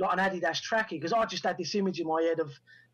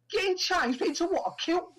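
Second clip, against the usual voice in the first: high-pitched, incredulous echo of what he was just told; bemused; 12 semitones higher than usual. Retold or imitated. Director questioning why an actor is getting changed, bemused. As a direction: imitate a baffled director, pitch leaping high. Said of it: "sort of bemused at why I'd be getting changed".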